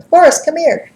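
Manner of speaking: high, loud mock call to a butterfly. Glenda calls the butterfly over, high and loud. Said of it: "And she started going".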